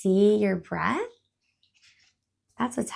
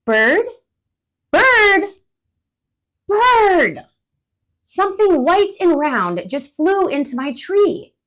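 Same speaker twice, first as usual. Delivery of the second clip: much louder, high calls of Bird; alarmed calling; 10 semitones higher, 11 dB louder than usual. Acted. Alarmed squirrel calling out. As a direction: squirrel yells for bird after snowball hits.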